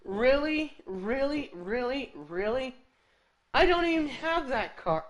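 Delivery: stuck in disbelief, asking again and again; incredulous repeats. Real, not acted. Streamer reacting to a game reward for a car he lacks, stunned repeats.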